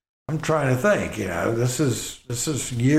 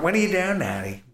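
imitating daughter; 10 semitones higher, faster than usual. Daughter's voice quoted again, higher.

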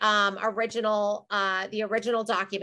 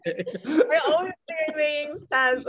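giggly; 6 semitones higher than usual. Real, not acted. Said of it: "that edible is kicking in".

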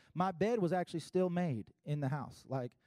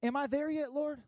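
put-on higher, pleading like an impatient kid; childlike plea to God; 10 semitones higher than usual. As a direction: ask God like a restless child. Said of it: "so many of us are asking God".

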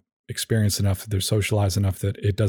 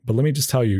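ordinary talk

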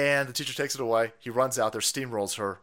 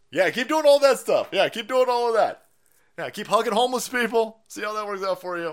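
mocking taunt; 6 semitones higher, 4 dB louder than usual. Real, not acted.

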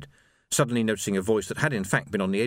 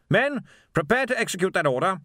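commanding captain; 9 semitones higher than usual. A narrator's reading. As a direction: command the squad crisply. Captain ordering men.

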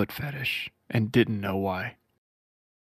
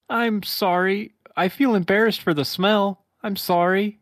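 Sonic voice; 9 semitones higher, 4 dB louder than usual. Acted. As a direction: apologise bashfully as Sonic. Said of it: "your Sonic voice is just golden".

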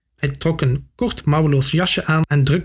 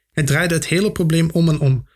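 plain reading voice

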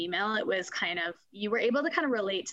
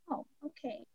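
small, soft, higher retold reply; meek surrender; 5 semitones higher, 10 dB quieter than usual. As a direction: imitate a chastened man giving in quietly. Scolded man meekly gives in.

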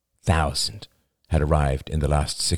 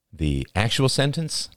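ordinary delivery, nothing marked

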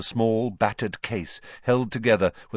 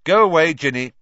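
loud, higher brotherly order; irritated sibling brush-off; brusque dismissal; 7 semitones higher, 11 dB louder than usual. Acted. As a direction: shoo a sister off loudly. Brother dismisses his sister.

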